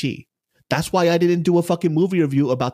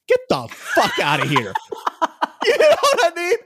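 laughing scoff. Real, not acted. Laughing at his own claim.

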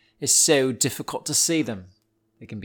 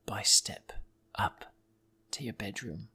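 near-whispered, suspenseful creeping; very soft, halting suspense; creepy hush; 5 semitones lower, 11 dB quieter than usual. Acted. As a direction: hush it step by step, as if sneaking. Imagining a snake creeping upstairs.

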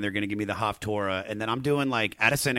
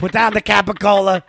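mock scolding; 10 semitones higher, 10 dB louder than usual.

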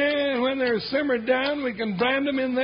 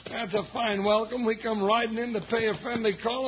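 usual speaking voice

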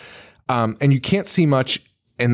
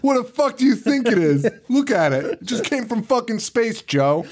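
Paul insisting; 9 semitones higher than usual. Pretend. Friend Paul voiced insisting.

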